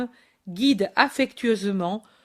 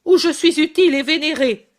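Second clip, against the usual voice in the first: loud, fast and higher; shouted reply; 6 semitones higher, 7 dB louder, faster than usual. Acted. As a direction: fling it back over his shoulder. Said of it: "se retourne et crie".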